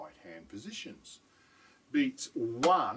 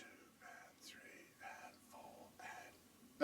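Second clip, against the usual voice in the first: whispered soft counts; 23 dB quieter, much slower than usual. Shown as a demonstration. Drum teacher showing soft tap strokes, whispered count. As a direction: whisper the unaccented beats. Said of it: "I'd count it like this".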